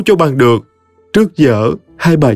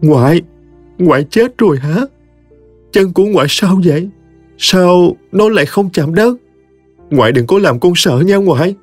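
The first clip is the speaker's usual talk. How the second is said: higher, pleading, a touch of fear; heartbroken pleading; 8 semitones higher than usual. Acted. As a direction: beg grandma to say it isn't true. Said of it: "Cô hỏi bà mà đau lòng quặn thắt". (giọng cao, van nài, đau lòng)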